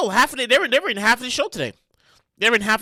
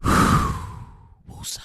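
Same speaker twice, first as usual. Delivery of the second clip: calming exhale; 5 dB quieter than usual. Real, not acted. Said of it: "I'm trying to woosah".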